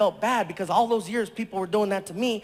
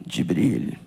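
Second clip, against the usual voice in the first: much lower, calm royal voice; prince's voice; 3 dB louder than usual. Retold or imitated. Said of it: "And he's like".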